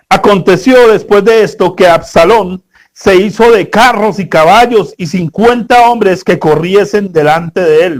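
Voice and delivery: scripture reading. Acted. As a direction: read the verses out with weight. Reads the Bible passage aloud.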